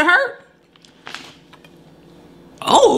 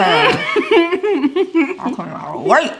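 laughing; 6 semitones higher, much slower than usual. Real, not acted. Teasing partner about camera going off.

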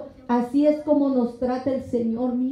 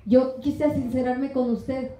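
ordinary delivery

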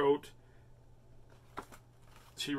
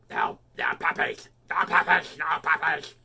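mock scolding voice with a lisp; lisping nagging wife impression; imitating Miss Chrissy; 6 dB louder than usual. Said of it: "does Miss Chrissy say?".

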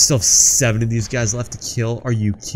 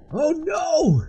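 very high squealed alarm; panicked cry. Pretend. Gamer getting beaten by an enemy, high panicked cry.

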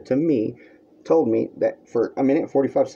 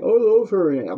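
high, whiny mock declaration of love; mocking Matt; 9 semitones higher, 3 dB louder than usual. Mocking Matt's claim of love for Megan, high and whiny. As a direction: parody his fake love. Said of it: "You know, like".

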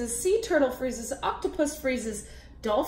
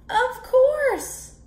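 character voice; 11 semitones higher, 4 dB louder than usual. Acted. Crab answering.

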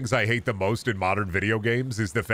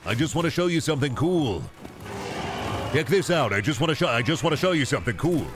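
higher, pestering uncle voice; eager uncle; 5 semitones higher than usual. Acted. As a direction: push your pictures like an eager uncle. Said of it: "I'm like your uncle at a family gathering".

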